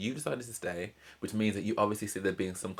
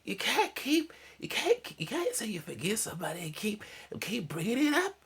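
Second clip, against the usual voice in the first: very high, strained Marge voice, partly whispered; Marge Simpson impression; 13 semitones higher than usual. Telling the Simpsons plot, voicing Marge scolding Homer. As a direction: pitch way up and squeeze like Marge. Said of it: "That's my best Marge Simpson impression".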